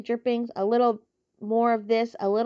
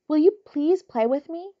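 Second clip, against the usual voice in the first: pleading; 7 semitones higher, 6 dB louder than usual. Acted. Wilbur pleads with lamb.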